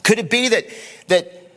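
rising, fervent sermon delivery over the band; impassioned preaching. Acted. Pastor to congregation, fervent preaching. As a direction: preach it out with building conviction.

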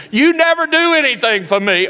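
put-on very high, loud child wail; accusing kid shrieking; tantrum scream. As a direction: throw the full tantrum.

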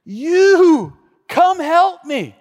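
high, loud, slow begging call; desperate plea. Retold or imitated. Climber begging the guide for help, loud and high. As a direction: beg him to come back, loud and slow. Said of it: "I said".

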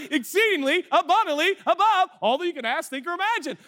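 high, giggling mock-scripture quote; laughing recital. God laughing over your dreams, high gleeful recital.

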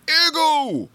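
a very high, loud yell of the name; screaming the name. Retold or imitated. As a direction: bellow it like a cartoon dad calling. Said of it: "always screaming his name like that".

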